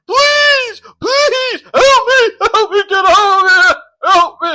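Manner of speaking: high, loud panicked yelling for help; desperate screaming for help. Retold or imitated. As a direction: scream it like you're stuck and terrified. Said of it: "screaming for me".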